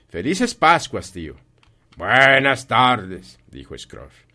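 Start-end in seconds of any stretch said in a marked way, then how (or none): 0.1-1.3 loud, higher, slow festive greeting; hearty good wishes. Acted. Nephew wishes his uncle happy Christmas, loud and hearty. 1.9-3.4 loud, very stretched-out goodbye; emphatic dismissal. Acted. Scrooge refuses to hear his nephew, loud slow dismissal.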